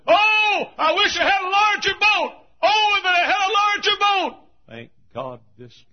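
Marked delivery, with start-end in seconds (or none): 0.0-4.4 put-on high, shouted lament from the old boatman; anguished cry. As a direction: wail it out across the water at full voice. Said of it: "he said".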